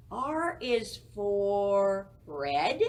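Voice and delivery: slow, emphatic teacher voice; slow stressed teaching. Shown as a demonstration. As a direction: draw out each word slowly, loud. Teacher stresses letter sound.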